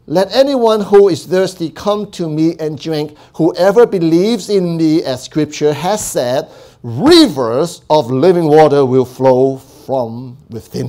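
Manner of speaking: slow, loud, ringing proclamation; Jesus crying out to the festival crowd, loud and slow. Retold or imitated. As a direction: call it out to the whole festival crowd. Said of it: "yelled in a loud voice".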